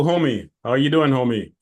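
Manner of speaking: ordinary talk, nothing marked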